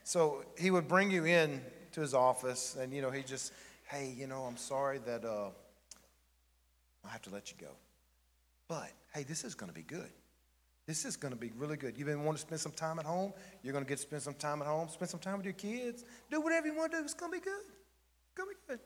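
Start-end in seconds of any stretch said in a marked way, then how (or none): 3.9-14.9 softly letting someone go as if it's good news; quiet, upbeat reassurance while imitating a boss; pleasant firing manner. Boss pleasantly firing an employee, softly reassuring. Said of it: "most pleasant experience you've ever had". 14.9-18.8 very high, soft mock-cheery voice; over-pleasant boss. Boss selling a firing as good news, absurdly high and soft. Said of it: "most pleasant experience you've ever had".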